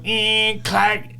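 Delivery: high, loud buzz and clack of a door; imitated door noise. Cohost retelling his release from prison, imitated door noise. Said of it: "when they go".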